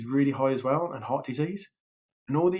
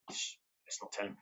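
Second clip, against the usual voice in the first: hushed conspiratorial whisper, much softer; 4 semitones lower, 12 dB quieter than usual. Real, not acted. Keeping a secret from his son, whispered aside.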